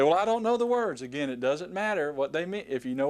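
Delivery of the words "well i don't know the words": mock student. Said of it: "And you say".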